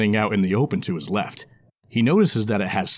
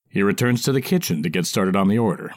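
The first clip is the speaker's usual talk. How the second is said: ordinary delivery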